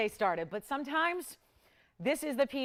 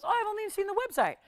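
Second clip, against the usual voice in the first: higher, mock-surprised voice for the caller; gentleman's voice; 11 semitones higher, 4 dB louder than usual. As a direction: play the puzzled gentleman, lifted up high. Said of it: "He goes".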